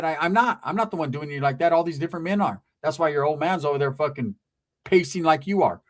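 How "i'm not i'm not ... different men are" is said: ordinary talk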